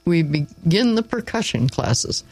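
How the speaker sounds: ordinary delivery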